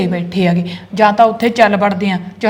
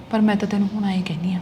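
hushed whisper to her husband; secretive whisper so nobody hears; 7 dB quieter than usual. Acted. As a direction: lean in and whisper it. Wife confides secret plan to husband.

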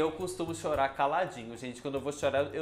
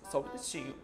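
mock tearful aside; 4 semitones lower, 6 dB quieter, faster than usual. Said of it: "vai falar pra câmera e fala".

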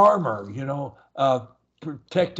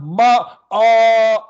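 long held vowel, much louder and higher; 7 semitones higher, 9 dB louder than usual. Shown as a demonstration. Coach showing you can make it loud, loud held sound. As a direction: belt out a sustained "ah". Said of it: "you can make it loud".

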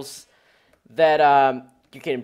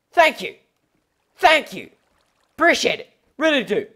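exaggerated thanks; 9 semitones higher, 4 dB louder than usual. Mock thanks after getting rid of it.

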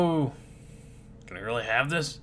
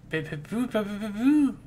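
rising, high beeps and boops; voiced beeping; 8 semitones higher than usual. Reader voicing a call going out, high beeping. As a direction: imitate a beeping gadget, climbing higher.

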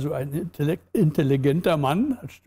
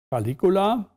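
usual speaking voice

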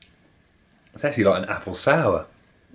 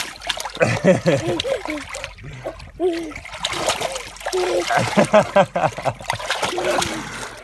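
long high laughter. Real, not acted.

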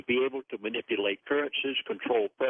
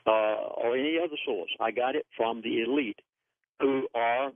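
ordinary talk

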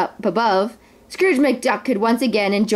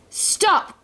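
high-pitched mock cry of alarm; urgent, raised-pitch cry; 9 semitones higher than usual. A narrator's reading. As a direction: call out high, without much volume. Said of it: "cried Scrooge".

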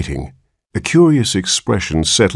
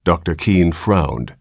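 usual speaking voice

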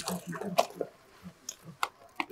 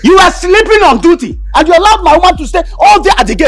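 higher, sharp scolding; angry scolding; 7 semitones higher than usual. Acted. Boss scolding the sleeping gatekeeper, angry. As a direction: tear into him.

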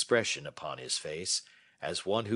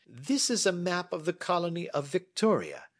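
a touch higher, measured and assured; lightly voiced Holmes; 11 semitones higher, 4 dB louder than usual. Holmes inviting Watson to sit and listen, lightly voiced. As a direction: hold forth like a confident detective.

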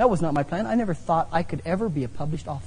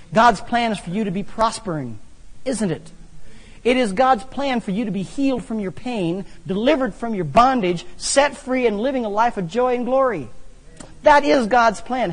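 building louder, preaching God's plan; preaching at full force; 5 semitones higher, 6 dB louder than usual. Real, not acted. Preacher urging the congregation about God's plan, fervent and loud. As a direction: rise into a fervent preacher's call.

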